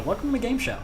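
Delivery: high-pitched mock host welcome; playful, swooping show-opener; game show host.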